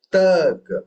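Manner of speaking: "Ta" hit loud and high as the phrase restarts; stress on first syllable. Shown as a demonstration. Teacher marks the starting phrase for listeners, accented "Ta". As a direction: punch "Ta", lighter "ka". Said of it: "Now they know that".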